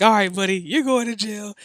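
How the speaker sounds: hammed-up officer laying down the law; stern cop. Cop tells cussing man he's going to jail, mock stern.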